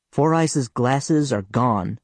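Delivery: ordinary delivery, nothing marked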